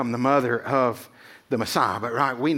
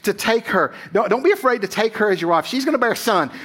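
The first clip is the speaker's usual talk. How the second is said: eager angel; 6 semitones higher than usual. Retold or imitated. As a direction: play the angel urging Joseph in a dream, high and pressing. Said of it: "and he's like".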